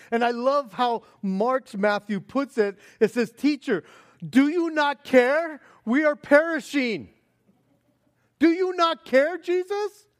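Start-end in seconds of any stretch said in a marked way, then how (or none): 3.3-10.1 high, loud, panicked questioning; desperate plea. Acted. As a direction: beg him to wake up in alarm. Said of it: "I don't think it was a nice, calm, easy voice".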